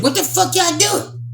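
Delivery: high-pitched imitation of a startled intruder; shocked retelling. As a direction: squeal it out, imitating a shocked brother. Retold brother bursts in, shocked.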